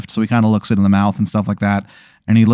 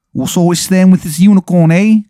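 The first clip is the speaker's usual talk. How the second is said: loud, high mock English punk; unconvincing English accent; 7 semitones higher, 5 dB louder than usual. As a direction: announce yourself in hammed-up bad English. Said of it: "his accent isn't convincing at all".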